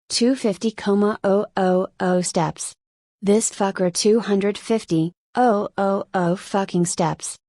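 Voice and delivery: panting between words, slow; gasping through exhausted counting; out of breath. Acted. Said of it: "Gasping for air".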